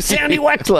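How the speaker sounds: high-pitched yelled name; mock yelled character name; Adam Sandler yelling. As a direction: call it out in a high goofy voice. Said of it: "Sandler just yelled lines".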